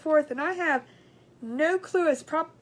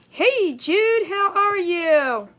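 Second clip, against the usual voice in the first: drawn-out slow greeting, higher and louder; sing-song greeting; 5 semitones higher, 8 dB louder, much slower than usual. Real, not acted. Streamer greeting a viewer by name, slow and sing-song. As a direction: stretch the hello out to the viewer.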